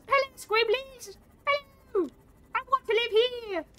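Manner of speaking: put-on very high, baby-talk voice; cutesy greeting. Streamer cooing at the Squimblies in a high baby voice. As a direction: coo at the little creatures. Greets alien creatures cutely.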